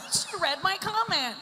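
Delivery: high-pitched mock excitement; gushing put-on high voice; delighted troll. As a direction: squeal it like a thrilled commenter. Voicing a troll in the audience.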